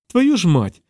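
mild narrated outcry; crying out. A narrator's reading. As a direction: exclaim it as a startled curse. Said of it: "скрикнула Фіона".

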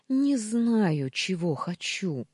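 high, loud start, slowly falling away; tearful outburst. A narrator's reading. Wife in tears to the maid, distraught outburst. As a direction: cry it out in distress, drawn out. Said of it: "сорвалась я, и слёзы сами потекли".

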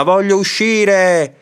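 high, stretched cry to be let out; angry shouting. A narrator's reading. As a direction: shout your demand, dragging the words. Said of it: "gridò zi Dima". (grido rabbioso, acuto e prolungato)

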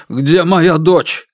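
halting, shaken question; voice breaking. Acted. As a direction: ask it with a breaking voice. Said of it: "спросил прерывающимся голосом".

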